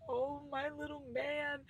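put-on high, slow, cooing baby voice; soft doting coo to a dog; baby talk to dog. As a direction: coo at the puppy like he's tiny. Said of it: "I was like".

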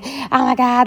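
high-pitched mock panic, not truly screamed; retold panicked screaming. Retelling kids' terror as dad drives at harbour, mock panic. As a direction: imitate kids panicking, at normal volume. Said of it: "everyone was screaming".

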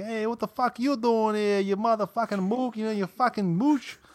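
put-on rough-house the insult like an old Yankee; crusty old-timer ribbing someone; mock Yankee insult. Imitating Dick's hostile banter.

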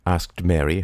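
ordinary delivery

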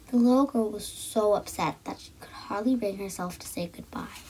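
ordinary delivery

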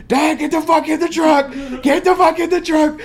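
high, loud shouted command, re-enacted; acted-out frantic shout; urgent yell. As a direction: yell a panicked order at your dad. Retold urgent yell at dad.

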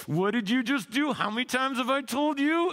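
put-on high, sharp scolding of a child; a parent losing patience, mock-scolding; exasperated parent. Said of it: "this is a parent".

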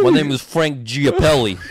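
high, loud cartoon Italian New Yorker; Italian New York accent. Co-host playing the fan they describe, Italian New York accent. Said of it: "stereotypical most Italian New York dude".

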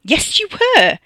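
high, loud contradiction; sharp retort. A narrator's reading. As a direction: throw it straight back at him. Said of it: "Tina retorted".

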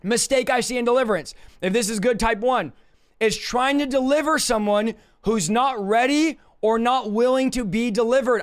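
ordinary delivery

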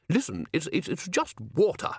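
snapped. Acted. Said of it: "snapped Ridcully".

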